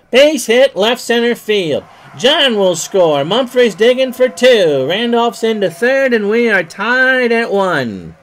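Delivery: loud, high excited call. Acted. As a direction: sell the wild throw loudly. Tying run scores.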